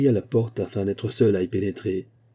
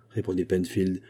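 ordinary delivery

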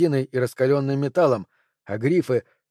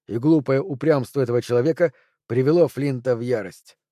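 plain reading voice

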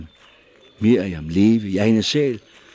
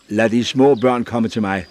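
loud call across the crowd; louder, summoning the children; 3 semitones higher, 8 dB louder than usual. A narrator's reading. Prophet calls over the disciples' heads, loud. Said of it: "råbte han".

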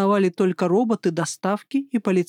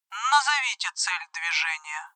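robot voice; 3 semitones lower, 6 dB quieter than usual. Acted. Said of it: "электронный голос робота".

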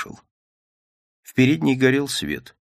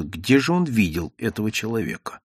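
ordinary delivery

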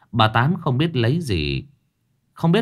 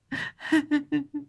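sobbing whimper; 7 dB quieter than usual. A narrator's reading. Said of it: "mếu máo khóc".

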